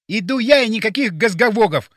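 high, loud, decisive declaration; resolute. A narrator's reading. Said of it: "решительно сказал он".

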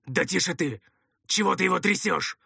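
rough, hoarse man snapping in anger; angry, commanding bark. A narrator's reading. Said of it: "В сердцах рявкнул второй голос, хриплый и грубый, с чёткими властными нотками".

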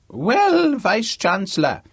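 put-on very high, loud old-man croak; hammed-up ancient man addressing an official; crotchety old man. A narrator's reading. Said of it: "his voice loud, old, and very convincing".

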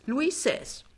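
lofty, high show-off; name the style grandly; proud, then faltering over it. A narrator's reading. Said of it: "sagt Frau Pinneberg stolz".

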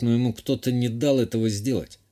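usual speaking voice

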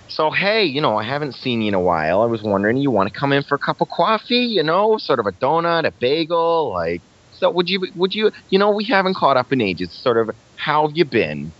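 put-on high-pitched grandma voice, Staten Island accent. Impersonating grandma inviting someone in for coffee, Staten Island accent. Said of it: "that's kind of how my grandma talks".

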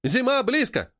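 high, rousing slogan cry; drilled slogan reply. A narrator's reading. Seamstresses answer Геля's question, crying the slogan. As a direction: call back the slogan with vigour.

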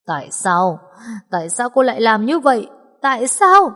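husband hissing at his scheming wife, building to a yell; furious hiss. Acted. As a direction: demand an answer, anger building. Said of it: "giống như tiếng rít căm phẫn".

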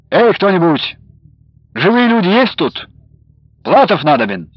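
loud, high, imperious call; commanding shout. A narrator's reading. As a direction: bark for someone to come. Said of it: "властный окрик".

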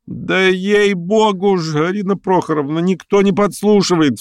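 very high, whining-pitched plea; exasperated protest. A narrator's reading. Pianist jumping up to protest, exasperated. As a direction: swear it in a high, drawn-out voice. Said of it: "вскочил Лямшин".

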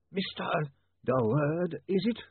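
very slow, high, drawn-out formal voice; zombie lawyer. Acted. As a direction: stretch each word with chilly formality.

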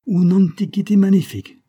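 put-on high, loud boast in Italian accent; ringing, grandly proud; proud proclamation. A narrator's reading. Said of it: "proclama fièrement le vieillard".

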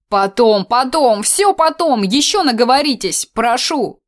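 оживлённо торопит, выше обычного; подгоняй весело; торопливо. A narrator's reading. Игорёк торопит девушек. (торопливо, громче)

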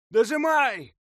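loud high battle cry; shout. A narrator's reading. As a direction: yell it across the battlefield. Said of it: "раздалось со стороны зажатых танков".

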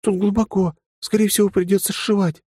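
put-on high, earnest young woman's voice; serious young woman. The lieutenant urges him again.

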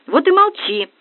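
high, loud furious snap; furious retort. A narrator's reading. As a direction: blow up at her, red in the face. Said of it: "вновь налился синевой приятель".

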